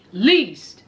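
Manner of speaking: low, emphatic "least"; dramatic drop. Acted. Host introducing Indy, low emphatic least. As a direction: drop it down heavy.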